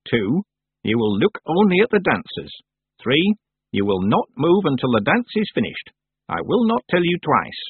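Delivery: lightly voiced stern old witch. A narrator's reading. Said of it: "said the old witch".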